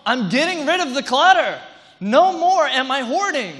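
high, loud mock declaration; fed-up resolve. Pastor acts out a listener vowing to declutter, high and loud. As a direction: proclaim it like a big decision. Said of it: "and you said".

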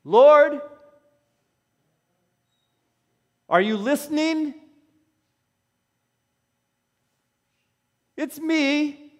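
high, loud mock plea for help; silly crying out. Pastor playing someone crying out to the Lord, silly loud plea. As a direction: call up to heaven in a playful plea. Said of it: "say that in sort of a silly way".

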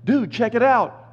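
lightly voiced casual angel. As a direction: say it like a dude pointing. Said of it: "So he's saying".

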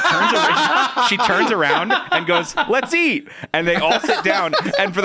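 high, giggling retelling; can barely get it out for laughing; laughing retelling of a cartoon; laughing through the story. Real, not acted. Laughing while retelling scene.

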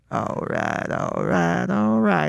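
McConaughey himself in the lineup nailing his catchphrase; the real McConaughey. Retold or imitated. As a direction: drop deep into the real drawl. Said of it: "he walks up and he's like".

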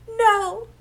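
high, flat-out refusal; blunt no. Retold or imitated. Host voicing Amy's curt reply to Luna, high. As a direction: shut the question down in one word.

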